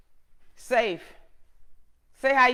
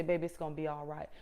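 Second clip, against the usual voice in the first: soft, fast reassuring murmur to her husband; much softer, hushing him like she's calming him down; soothing husband; 7 semitones lower, 11 dB quieter, faster than usual. Retold or imitated. Soothing husband while stroking him.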